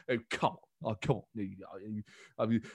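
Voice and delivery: lightly voiced mock incredulity; scoff, then trail into low chuntering; Boris. Prime minister brushing off an accusation, mock incredulity. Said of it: "that sort of mock incredulity".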